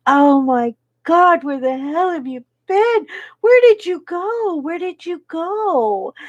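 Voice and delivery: frantic father. Retold or imitated. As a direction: act out a frantic parent finding a missing child. Retold father frantic over missing child.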